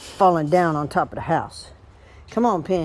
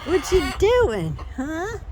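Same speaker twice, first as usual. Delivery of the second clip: pet talk; 12 semitones higher, much slower than usual. Real, not acted. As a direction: coo at her like a pet, giggling. Cooing at a hen.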